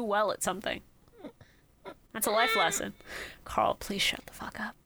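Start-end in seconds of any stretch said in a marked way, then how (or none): 3.4-4.7 unvoiced whispered aside; whispering. Real, not acted. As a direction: whisper it harshly.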